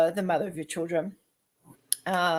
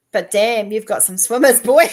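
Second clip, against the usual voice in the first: loud teasing; 4 semitones higher, 12 dB louder than usual. Real, not acted. Teasing about fertility.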